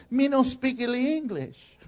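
put-on slow, broken-English foreigner accent; mock foreign accent. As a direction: play clueless in a hammed-up foreign accent. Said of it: "he's saying this".

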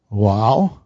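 ordinary talk

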